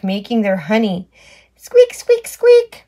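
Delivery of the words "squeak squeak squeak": high, loud squeaky toy squeak; mock squeak. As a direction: squeak like a tiny mouse toy. Squeaky book sound effect.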